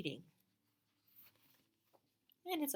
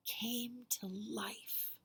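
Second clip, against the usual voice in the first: slow breathy whisper; whispered storybook reveal; dramatic whisper; 3 semitones higher, 5 dB quieter, much slower than usual. A narrator's reading. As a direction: let the magic moment hush to a whisper.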